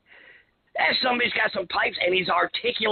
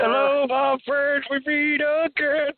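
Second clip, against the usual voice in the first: slow, higher, put-on rock-singer croon; 7 semitones higher, much slower than usual. Cohost parodying the Creed singer's vocal style. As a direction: croon it like a self-important frontman. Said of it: "the singer from Creed".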